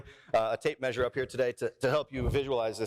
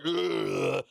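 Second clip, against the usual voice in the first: mock groan under heavy weight; strained grunt. Preacher imitates Goliath in hundred-pound armor, mock straining grunt. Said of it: "He's like".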